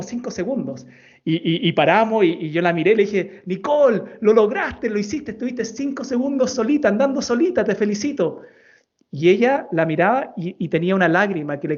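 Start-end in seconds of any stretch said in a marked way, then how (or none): 3.5-8.4 put-on higher, beaming praise for a child; dad congratulating his daughter after her first solo ride; proud praise.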